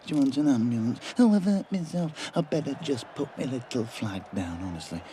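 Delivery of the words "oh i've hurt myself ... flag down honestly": high, whiny mock voice of a hurt linesman; mocking a wimpy linesman. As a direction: whine it like a sissy nursing a scratch.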